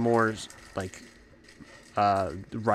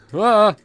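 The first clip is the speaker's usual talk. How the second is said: 1 sharp yelp; 10 semitones higher, 10 dB louder than usual. Real, not acted. Hit in game.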